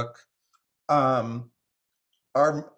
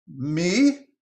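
high, loud, rising question; rigid with surprise; 6 semitones higher, 6 dB louder than usual. Acted. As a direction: squeak it in affronted surprise. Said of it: "rigid with surprise".

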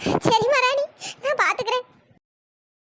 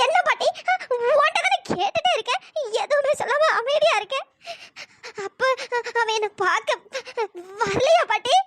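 ordinary delivery

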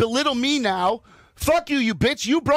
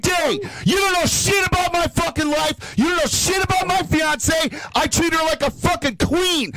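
screaming insults at full force; furious yelling; 7 semitones higher than usual. Real, not acted. Guest screams at the caller who called him abusive. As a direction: roar it out in raw fury. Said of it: "I've never heard a man yell louder".

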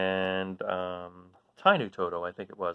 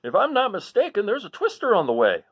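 Uncle Henry bellowing, higher and louder; 13 semitones higher, 9 dB louder than usual. Acted. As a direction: call it out over the storm like a farmer. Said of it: "Uncle Henry was bellowing".

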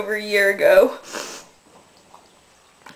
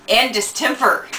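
ordinary talk, nothing marked

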